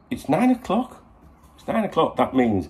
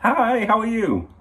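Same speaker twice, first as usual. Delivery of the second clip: affectionate greeting; 6 dB louder, slower than usual. Real, not acted. Greeting girlfriend on call.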